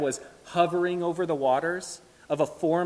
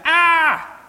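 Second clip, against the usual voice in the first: high, loud "ah" scream; startled, full-voiced shriek of alarm; chased-by-bees yell; 12 dB louder than usual. Shown as a demonstration. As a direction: cry out as if fleeing wasps. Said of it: "The sound he's making".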